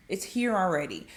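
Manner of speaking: normal talking voice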